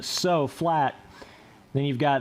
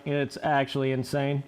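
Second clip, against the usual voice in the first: ordinary delivery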